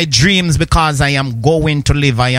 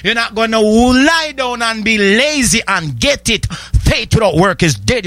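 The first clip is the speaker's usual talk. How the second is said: full-force preaching; 6 semitones higher than usual. Acted. Preacher urging the congregation to take victory, shouting. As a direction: drive it home like a revival preacher.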